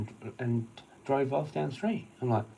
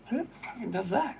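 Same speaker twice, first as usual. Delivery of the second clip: much higher, put-on disbelief; mock incredulous voice; 12 semitones higher than usual. Retelling his stalker theory, high mock disbelief. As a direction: squeak it out in fake outrage. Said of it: "I'm like".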